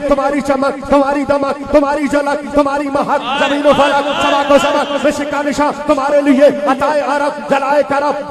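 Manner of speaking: high, driving rhythmic declamation; fervent verse recitation. Real, not acted. Preacher addressing praise verses to the Prophet, high insistent recitation. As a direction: hammer out short lines on a sustained high pitch.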